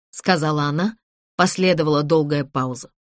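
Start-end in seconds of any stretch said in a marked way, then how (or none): none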